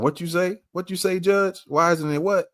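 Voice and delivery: high-pitched goading questions; egging on. Acted. Reactor cheers on the judge in the clip, high-pitched egging on. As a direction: pipe up high, prodding for a repeat.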